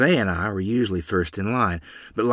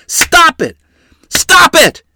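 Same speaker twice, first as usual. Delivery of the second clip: piercing, very loud and high; frantic shriek; 14 dB louder, slower than usual. A narrator's reading. Mother pulls at father's clothes, shrieking frantically. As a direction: scream to halt it. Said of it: "Mother shrieked".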